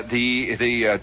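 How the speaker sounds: plain reading voice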